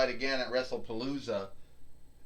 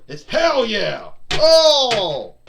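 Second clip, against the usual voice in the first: much louder, higher hammed-up yell; catchphrase shout; 7 semitones higher, 13 dB louder, much slower than usual. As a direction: roar the catchphrase.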